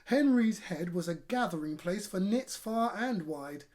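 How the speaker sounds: plain reading voice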